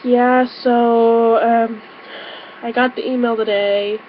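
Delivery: casual, held-back, softly trailing delivery; playing it down. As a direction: act like it's no big news. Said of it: "trying to play it down".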